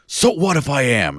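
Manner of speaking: slow, defiant, lightly voiced bigot; defiant Nazi. The Nazi at the bar admits it, slow and defiant. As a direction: sneer it back, unbothered. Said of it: "He goes".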